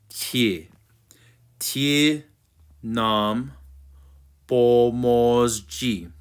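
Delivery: "Tie nam pomozi" slowly, word by word; pronunciation model. Shown as a demonstration. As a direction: stretch "pomozi" evenly after tyih, nahm. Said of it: "So we're gonna do".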